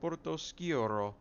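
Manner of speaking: Portoscuro drawn out slowly in a hammed-up Italian accent, pitched high; best mock Italian accent on Portoscuro. Shown as a demonstration. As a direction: roll out Portoscuro like a proud Italian. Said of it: "That was my best Italian accent".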